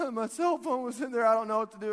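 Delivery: high, loud, imitated wail; mock distress. Mom to jogger after lake, mock distress.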